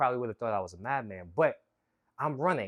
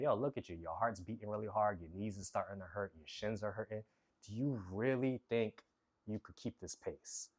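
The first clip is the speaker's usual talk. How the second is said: a quieter, sinking voice that talks him down; doubting inner voice; 4 semitones lower, 8 dB quieter than usual. Retold or imitated. Doubt inside his head mid-run, quiet and discouraging. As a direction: voice the nagging doubt in your head, hushed. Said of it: "the voice actually started to talk to me".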